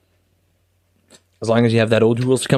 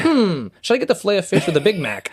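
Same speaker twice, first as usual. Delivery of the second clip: mock dim musing; 6 semitones higher than usual. Voicing a stranger's thoughts.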